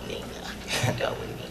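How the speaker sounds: laughing. Acted. Wife laughing, fading.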